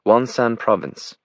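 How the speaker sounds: high, slow British delivery; deliberate aristocratic British; British accent. A narrator's reading. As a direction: place name in upper-class British English. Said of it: "in her British accent".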